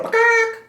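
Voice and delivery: very high, loud hen squawk; hen cluck. A narrator's reading. Animals chase the farmer.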